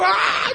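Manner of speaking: high startled yelp; startled child cry. Retold or imitated. Child startled as grandma wrings the neck, yelp. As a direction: jump back in fright.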